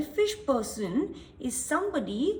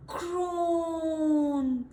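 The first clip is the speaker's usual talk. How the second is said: long drawn-out groan of pain; crocodile groan; 3 semitones higher than usual. Acted. Crocodile with a swollen jaw groaning in pain. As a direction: moan like a hurting crocodile. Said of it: "That's the sound that's coming".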